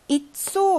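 이쏘 opening on a plain "i" sound, stress on the drawn-out 쏘; unstressed ya as i. Shown as a demonstration. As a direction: say the first vowel of 이쏘 as "ee", then stretch 쏘. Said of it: "야의 강세가 없으니까 이 발음 바뀝니다".

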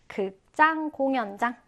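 normal talking voice

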